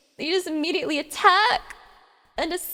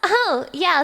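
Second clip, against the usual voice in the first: normal talking voice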